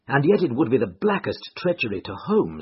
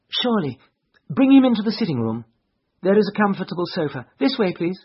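high, quick, raised lady's voice; lady giving directions; 9 semitones higher, 6 dB louder, faster than usual. A narrator's reading. Irene tells bystanders to carry the man in, brisk lady's voice. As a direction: usher them in briskly, pitched high.

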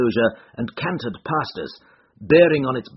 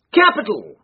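high, louder delighted exclamation; sudden cry; 11 semitones higher, 4 dB louder than usual. A narrator's reading. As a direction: burst out with delight. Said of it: "he cried suddenly".

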